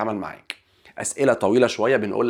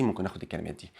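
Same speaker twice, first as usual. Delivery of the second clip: "ممكن اخد الكلمات دي" repeated much softer and lower; 6 semitones lower, 12 dB quieter, faster than usual. Shown as a demonstration. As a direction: drop to a quiet aside. Teacher repeats phrase, much softer.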